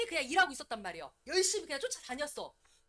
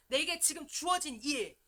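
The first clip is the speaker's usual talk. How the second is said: ordinary delivery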